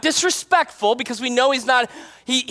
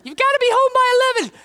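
high, quick mock scolding; nagging parent; 13 semitones higher, faster than usual. Parent ordering a child home, mock nagging. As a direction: imitate a parent laying down curfew. Parent voiced in curfew joke.

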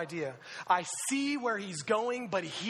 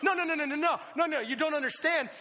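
high, loud rush of no's; frantic, trying to get through to someone; panicky; 8 semitones higher, 4 dB louder than usual. Retold or imitated. Driver warning an oblivious rider, panicky. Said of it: "I begin to, like, kinda panic".

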